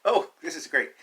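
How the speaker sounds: light up at the page; excited. Real, not acted. Teacher reaching the YouTube page, delighted exclamation.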